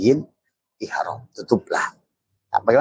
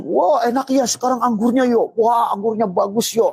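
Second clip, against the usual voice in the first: high, gushing mock delight; hammed-up pleasure, much higher; carefree delight; 14 semitones higher than usual. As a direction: gush over the grapes like a careless eater. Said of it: "Tapi dia malah asik".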